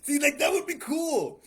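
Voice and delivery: loud, slow, thrilled; excited shout. Real, not acted. To his guest, loudly thrilled at a knife backstory. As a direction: shout it out, delighted by the idea.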